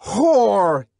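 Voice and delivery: enraged man shouting down at the woman; furious shout. Acted. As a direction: spit the word out in fury. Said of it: "he shouted".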